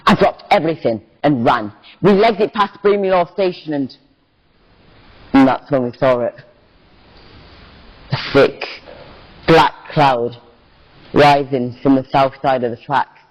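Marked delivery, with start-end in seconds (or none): none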